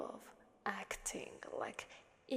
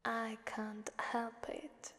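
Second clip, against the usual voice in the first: usual speaking voice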